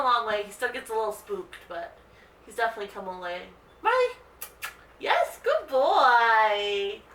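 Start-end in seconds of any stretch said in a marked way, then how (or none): 3.8-4.2 name called much higher and louder, cheerful; happy tone. Shown as a demonstration. Owner demonstrating how to call the dog, high happy call. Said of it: "say their name ... in a happy tone". 5.0-7.0 slow praise. Real, not acted. Owner praising her dog Marley, slow drawn praise.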